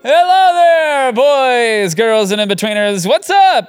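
high, loud, slow showman greeting; big podcast host welcome; announcer. Acted.

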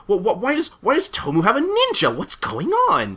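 very high, stammering disbelief; bewildered crowd. Acted. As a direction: blurt it like a baffled onlooker.

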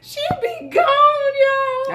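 high shout through laughter; laughing so hard it breaks high; crying laughing. Real, not acted. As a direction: yell it while cracking up. Laughing at friend's fast walk.

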